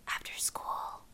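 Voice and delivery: soft unvoiced whisper. Cindy asks the narrator in class, whispering. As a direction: whisper it under the teacher's nose.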